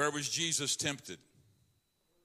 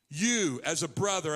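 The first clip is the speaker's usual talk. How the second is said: usual speaking voice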